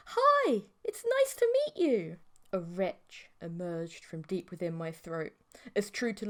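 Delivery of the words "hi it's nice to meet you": put-on high, sweet girlish giggle voice; giggly mantis girl. A narrator's reading. Insect girlfriend greets the dreamer, high and sweet. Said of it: "She giggled softly".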